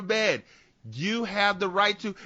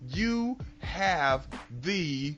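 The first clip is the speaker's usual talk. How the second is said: slow, drawn-out cop reading rights; mock Miranda reading; 2 semitones lower, 4 dB quieter, much slower than usual. Officer reading her rights, slow and drawn out. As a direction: stretch each word like reading rights.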